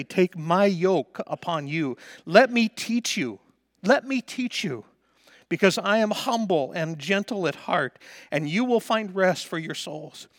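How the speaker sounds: loud, high, ringing preacher's voice; preaching at full force. Acted. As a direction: lift the scripture to the rafters.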